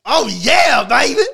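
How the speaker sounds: loud, high eager yell; excited shout. Acted. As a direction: shout it like you're thrilled.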